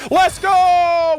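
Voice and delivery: loud, high cheer of victory; excited shout. Real, not acted. Gamer cheering his own perfect dish, excited shout. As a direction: yell it out, thrilled. Celebrating a gaming win.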